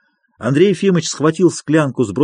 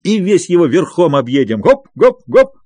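loud, high hearty bluster; boisterous merriment; 5 semitones higher, 4 dB louder than usual. A narrator's reading. Friend cheering up his companion with Caucasus plans, boisterously merry. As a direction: crow it jovially with stretched words. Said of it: "захохотал Михаил Аверьяныч".

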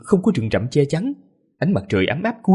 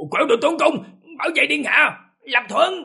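barking orders; 14 semitones higher than usual. Acted. Guard shouting battle orders.

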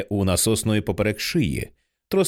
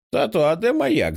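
put-on high little boy's voice, puzzled; child's question; 12 semitones higher than usual. A narrator's reading. Small son asks his father where the lighthouse went, high and childlike. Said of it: "запитує Джек".

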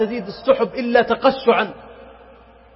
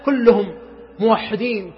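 ordinary talk, nothing marked

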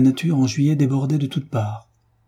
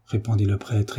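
plain reading voice

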